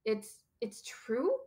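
very slow, halting question; shaken, faltering disbelief. A narrator's reading. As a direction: stumble hesitantly into the question. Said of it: "faltered Professor McGonagall".

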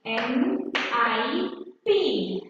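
N I P spelled letter by letter, slow; spelling out slowly. Shown as a demonstration. Teacher spelling nip for pupils, slow and deliberate. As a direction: give each letter of N I P its own beat.

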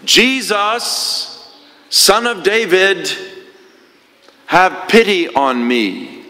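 high, slow pleading call; beggar crying out. Acted. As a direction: call out to Jesus from the roadside, begging. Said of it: "Bartimaeus shouts".

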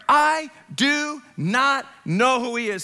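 slow, weighty "I do not know"; emphatic sworn denial. Acted. Peter cursing himself before bystanders, slow emphatic "I do not know". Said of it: "On himself and to swear".

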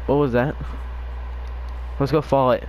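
normal talking voice

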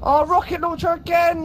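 outraged cry. Real, not acted. Gamer killed by a rocket launcher again, outraged high cry.

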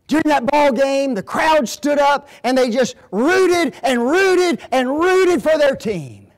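loud, high, slowed preaching voice; mock preaching boom. Preacher retelling his England sermon, loud and slow.

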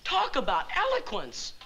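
ordinary delivery

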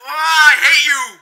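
startled shriek at a jump scare; scared scream. Real, not acted. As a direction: scream in real fright. Jump scare in horror game.

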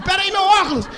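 flustered loud call; frantic shout. Retold or imitated. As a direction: yell it while searching the ground. Friend lost his glasses mid-fight.

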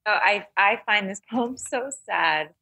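usual speaking voice

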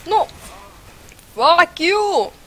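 loud high furious curse; angry yell. Real, not acted. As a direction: yell it at the enemy. Cursing enemy after death.

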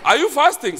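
loud, high punched repeat. Retold or imitated. Guy repeating it to the whole room, loud. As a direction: hammer the question so all hear. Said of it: "he says it loud".

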